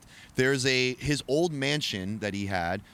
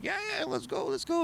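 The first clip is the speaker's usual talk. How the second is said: imitated hustlers; 4 dB quieter than usual. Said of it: "they're like".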